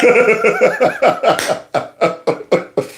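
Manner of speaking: long, wheezy laughing fit. Real, not acted. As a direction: crack up at length. Laughs at the clip.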